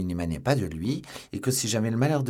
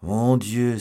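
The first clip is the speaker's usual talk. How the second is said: loud, hollow-voiced exclamation; hollow cry; 3 semitones higher, 8 dB louder than usual. A narrator's reading. Athos cries from inside the cellar, hollow-voiced. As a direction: call out hollowly. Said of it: "s'écria la voix creuse d'Athos".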